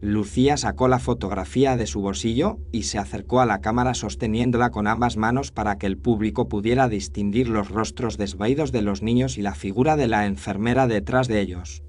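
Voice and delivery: plain reading voice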